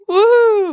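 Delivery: put-on high loud drunken woo; a shrill cheer from the front row; weird woo. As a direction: whoop it like a tipsy fan. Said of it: "which is a weird woo".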